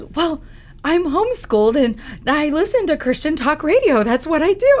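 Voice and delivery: put-on high, loud, earnest kid explaining himself; acted-out youngster's high-pitched explanation; imitated teenage boy. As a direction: voice a homeschooled kid defending his habit.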